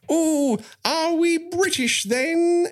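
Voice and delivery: high, slow mock British; drawn-out posh British quip; fake British accent. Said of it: "she announced to the entire group".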